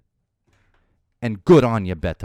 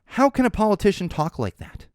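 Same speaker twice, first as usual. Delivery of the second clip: raised, mock-outraged pearl clutcher; mock shock at the swearing; mocking quote; 8 semitones higher than usual. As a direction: ham up the scandalized tone. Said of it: "and I quote".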